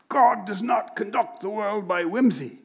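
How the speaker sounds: a hint of the British rabbi, lifted and weighty; quoting the rabbi. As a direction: repeat the line as a solemn quotation. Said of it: "he sounds like him".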